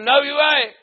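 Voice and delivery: loud, high contradiction; shouted refusal. Retold or imitated. Man barring the visitor's way, shouted refusal. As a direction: yell it to block him. Retold quarrel at the gate.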